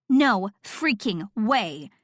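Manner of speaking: "No freaking way!" slow, every word hit hard; each word emphasized. A narrator's reading. Stunned friend slaps table, "No freaking way!" word by word. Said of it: "to emphasize each word".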